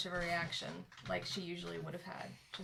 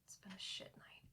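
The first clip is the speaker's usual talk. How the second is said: very soft, drained admission; hushed, worn-out mutter; weary whisper; 11 dB quieter than usual. Acted. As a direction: breathe it out wearily. Sick character admits bad night.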